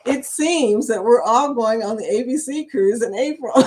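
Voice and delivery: ordinary talk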